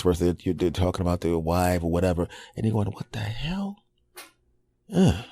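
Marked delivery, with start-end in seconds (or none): 2.9-5.2 baffled aside. Retold or imitated. Ex talks about their spouse.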